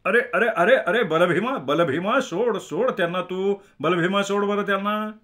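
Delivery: high, agitated calling out; alarmed intervention, words repeated in a high voice; urgent pleading. Acted. Acted pleading to release a man.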